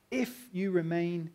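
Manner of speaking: "If" drawn out and weighted, slow; "If you remain" said slowly, all the weight on "If"; stress on IF. Shown as a demonstration. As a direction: lean hard on "If", then slow through "you remain". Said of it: "the big word, if".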